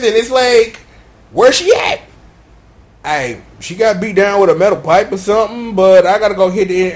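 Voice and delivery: usual speaking voice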